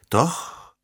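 usual speaking voice

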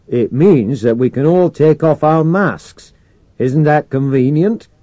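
loud, slow, high-pitched sarcasm; sarcastic. A narrator's reading. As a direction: spell it out with mock delight over the radio. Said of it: "echoing the sarcasm in Mercer's voice".

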